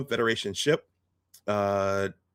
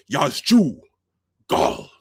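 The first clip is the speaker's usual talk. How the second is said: Klingon gunner; 4 semitones higher, 5 dB louder, slower than usual. Retold or imitated. Gunner answers commander in Klingon.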